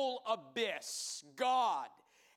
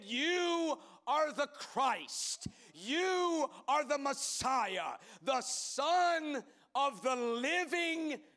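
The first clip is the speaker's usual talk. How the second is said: slow, higher, louder declaration; proclaiming; 4 semitones higher, 3 dB louder, slower than usual. Acted. Peter confessing Jesus as Messiah, slow ringing shout. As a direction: ring it out slowly to the rafters.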